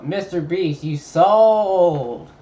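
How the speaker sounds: loud drawn-out cry of complaint; loud protest. Real, not acted. Fan let down by a missing outro, loud protest.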